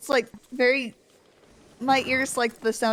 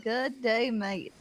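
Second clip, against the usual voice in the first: slow, careful learner's attempt; laboured, slow go at an Aussie greeting; far too slow; 5 dB quieter, much slower than usual. Real, not acted. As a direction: draw out each word separately. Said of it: "the opposite of reasonably fast".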